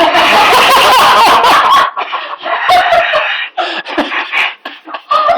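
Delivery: very high, long rising howl of laughter; long helpless laughter. Real, not acted. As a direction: lose it completely at the punchline. Punchline about the club.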